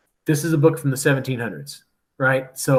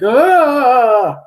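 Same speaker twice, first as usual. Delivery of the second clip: startled cry; 10 semitones higher, 7 dB louder than usual. Retold or imitated. Family startled by shouting pirate.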